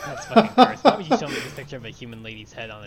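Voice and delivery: loud, laughing in disgust; disgusted laughter. Real, not acted. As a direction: recoil with a laugh, loud and fast. Disgusted at chicken picture.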